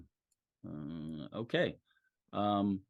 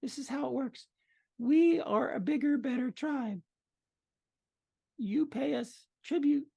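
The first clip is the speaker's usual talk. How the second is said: female goblin voice; 13 semitones higher than usual. Acted. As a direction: lay out the terms sweetly.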